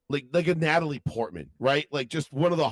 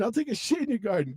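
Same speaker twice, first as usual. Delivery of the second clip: high-pitched mock threat; Cartman; 7 semitones higher than usual. Cartoon boy answering the old man, mock defiance. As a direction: threaten back, cartoon-style. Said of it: "Cartman just, like, shaking".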